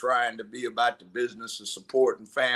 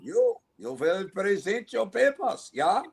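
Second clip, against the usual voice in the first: put-on higher-pitched German-accented officer; 7 semitones higher than usual. Officer demanding papers from a man, German accent. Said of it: "That's a really good German accent".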